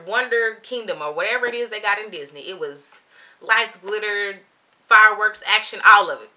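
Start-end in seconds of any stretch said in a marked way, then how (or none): 3.4-6.3 loud, punched list of wonders; hyped-up. Real, not acted. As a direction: sell the spectacle word by word, loudly. Hyping the magical store experience.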